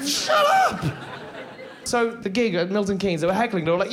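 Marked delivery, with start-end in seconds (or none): 0.0-0.8 very high, squealed outburst; acted high-pitched shriek; embarrassed yell. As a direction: yelp it back at teasing schoolmates. Acts embarrassed child yelling back.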